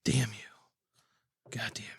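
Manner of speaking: hushed, breathy mock curse. Cohost whispers a mock curse after his pick is taken. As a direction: whisper a joking curse at him.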